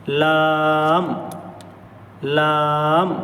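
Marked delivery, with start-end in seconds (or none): 0.1-1.1 لام held long; drawn-out lam demo. Shown as a demonstration. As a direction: stretch لام slowly for the student. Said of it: "بالکل simple ہے یہ".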